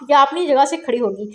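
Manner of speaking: ordinary delivery, nothing marked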